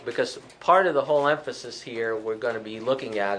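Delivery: ordinary talk, nothing marked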